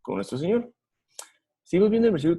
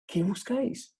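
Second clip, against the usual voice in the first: soft, higher, tender asking; loving question; 6 semitones higher, 4 dB quieter, slower than usual. Retold or imitated. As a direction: ask it gently, full of love. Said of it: "realmente muy en amor, preguntando".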